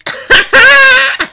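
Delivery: high, loud cackling laugh; triumphant cackle. Real, not acted. Voter celebrating her vote, cackling. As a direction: cackle gleefully.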